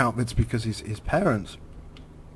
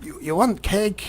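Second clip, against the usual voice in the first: high, slow mock Ukrainian mother; attempted Russian-Ukrainian accent; 8 semitones higher than usual. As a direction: offer it slowly in a put-on Russian accent. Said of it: "and then she'd be like".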